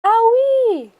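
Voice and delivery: high, loud yowl of pain; a cub's loud ow of pain. A narrator's reading. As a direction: yelp as the hot soup burns. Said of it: "yowled Baby Bear".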